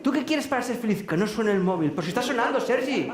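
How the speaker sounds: high-pitched, incredulous challenge; acting out an exasperated telling-off; exasperated self-scolding. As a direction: argue with yourself as if the answer were obvious. Acted argument with himself.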